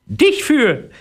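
very high parrot mother calling. Acted. Parrot mother calling down to the boy, high and squeaky. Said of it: "rief die Papageimutter".

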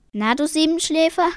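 high, loud teasing greeting; amused teasing. A narrator's reading. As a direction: tease the late riser with a grin. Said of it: "er schmunzelte". (schmunzelnd neckend)

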